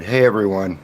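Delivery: ordinary talk, nothing marked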